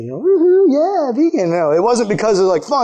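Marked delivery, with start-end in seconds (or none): 0.2-1.5 much higher, louder whooping cheer; mock vegan cheer. Preacher mocking vegans in the crowd, whooping high cheer. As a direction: ham up a vegan celebrating. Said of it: "some of you vegans".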